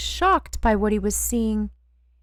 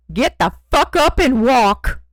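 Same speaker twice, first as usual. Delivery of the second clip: loud barked cop command; yelled order; 8 dB louder than usual. A narrator's reading. Cop Benoit yelling at Ernie to get up, loud. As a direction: bark it like a cop who means it. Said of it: "yelled Benoit".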